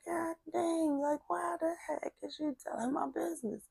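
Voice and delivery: high, soft exasperated whine. Retold or imitated. Girl whose grandma tells her business, retold soft exasperation.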